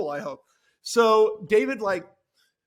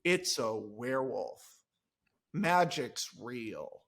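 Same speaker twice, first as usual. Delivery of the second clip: soft, low, slow wonder; awed whisper; 10 semitones lower, 8 dB quieter, much slower than usual. Retold or imitated. Alexis facing the werewolf, soft slow awe. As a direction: breathe it out in awe. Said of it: "she's like".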